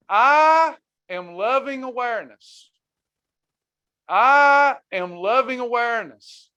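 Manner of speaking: affirmation to repeat. Shown as a demonstration. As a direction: lead the crowd in a calm affirmation. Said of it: "Everybody repeat after me".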